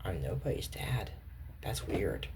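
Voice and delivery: a light low mock dad voice; grumpy dad reply. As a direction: mutter it low like a put-out grown-up. Imagined reply to being called Dad.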